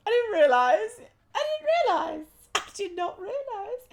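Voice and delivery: very high, laughing squeak; giggly falsetto disbelief; high-pitched squeal. Acted. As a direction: squeal it out, rising through the repeats. Mock-repeats own earlier line, laughing.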